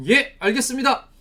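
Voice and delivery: eager, dutiful acknowledgement; obedient reply. Acted. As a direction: answer an order promptly and firmly. Acting obedient reply to God's order.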